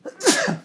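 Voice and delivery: sudden loud sneeze; real sneeze burst; sneezing. Real, not acted. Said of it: "I'm gonna sneeze again".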